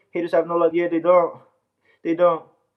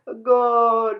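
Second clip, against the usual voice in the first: mock goal call; 6 semitones higher, 4 dB louder than usual. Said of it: "just say".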